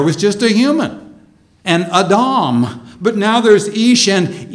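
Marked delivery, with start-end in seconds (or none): 1.9-2.8 "Adam" as ah-DAHM, stress on second syllable; Hebrew pronunciation. Shown as a demonstration. Preacher recalling the undivided human, "Adam" in Hebrew pronunciation, ah-DAHM. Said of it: "would be pronounced more like".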